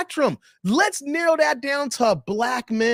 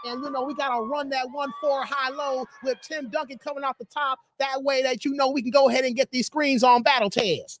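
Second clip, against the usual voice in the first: Avery Johnson impression. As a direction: impersonate a basketball coach calling plays. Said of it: "That's exactly how he talks".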